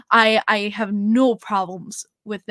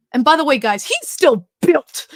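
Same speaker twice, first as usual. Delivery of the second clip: loud, stretched-out exclamation; loud, amazed announcement to the fans; emphatic gushing; 4 semitones higher, 8 dB louder than usual. Real, not acted. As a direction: belt it out, drawing out "still built". Gushing about how built he is.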